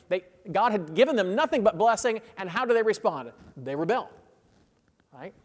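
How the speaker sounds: ordinary delivery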